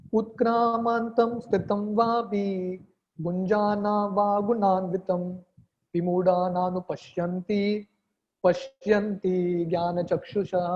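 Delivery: slow, measured chanting of a Sanskrit verse; Sanskrit verse recitation. Acted. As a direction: recite the verse slowly and solemnly.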